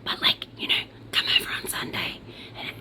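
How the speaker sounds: soft unvoiced whisper to the viewers. Real, not acted. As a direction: breathe it like a secret aside. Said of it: "I don't know why I'm whispering".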